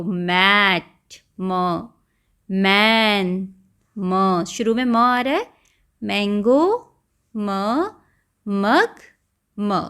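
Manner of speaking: word, then its first sound म; phonics drill of म. Shown as a demonstration. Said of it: "यहाँ पर म साउंड देखो".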